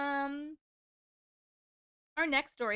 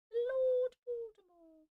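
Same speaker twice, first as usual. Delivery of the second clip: "Lord Baltimore" drawn out high and slow; haunted announcer drawing out "Lord Baltimore"; spooky title voice; 11 semitones higher, slower than usual. Pretend. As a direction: make "Lord Baltimore" sound eerie.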